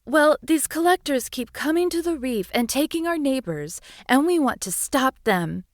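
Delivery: small high voice, earnest; a little seahorse pleading her case. Acted. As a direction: seahorse telling the witch about the collectors. Seahorse explains to witch.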